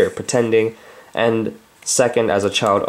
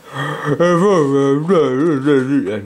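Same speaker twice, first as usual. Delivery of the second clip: gasp; 3 semitones higher than usual. Pretend. Reacting to a sad dog.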